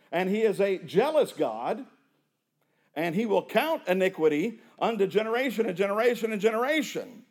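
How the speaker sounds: fiery sermon delivery; preaching at full force. Acted. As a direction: preach it, hammering each word. Builds preaching about God's judgment.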